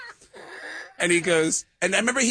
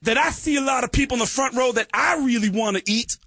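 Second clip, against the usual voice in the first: [name] stage voice; 8 semitones higher than usual. Retold or imitated. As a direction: belt it like a frontman working an arena. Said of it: "[name] does a rap".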